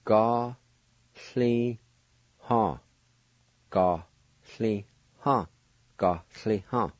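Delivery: "Ga, sli, ha" split into three clear syllables; teacher repeating "Ga, sli, ha" part by part. Shown as a demonstration. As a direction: sound out "Ga, sli, ha" slowly in parts.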